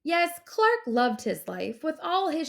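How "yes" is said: ordinary delivery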